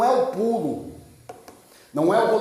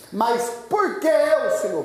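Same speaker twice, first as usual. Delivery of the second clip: acted plea, high then dropping; pleading protest; 4 semitones higher than usual. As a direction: push up high on the question, acting bewildered. Moses protesting God's call, acted.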